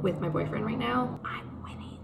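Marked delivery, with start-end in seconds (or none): 1.2-2.0 soft whisper, nearly voiceless. Real, not acted. Mid-game with her boyfriend, a hushed whisper. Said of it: "whispering mid Yahtzee game".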